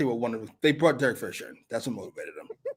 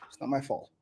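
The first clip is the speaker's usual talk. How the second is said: ordinary talk, nothing marked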